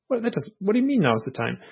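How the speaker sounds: a high, baffled what do you mean; exasperated confusion. Retold or imitated. Confused abductee pushes back at the beings, jumping high.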